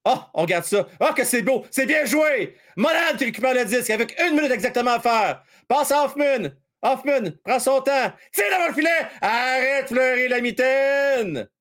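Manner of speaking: high, quick hockey commentary; play-by-play call. Acted. Streamer calling the last minute for viewers, play-by-play. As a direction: call the rush like a radio announcer.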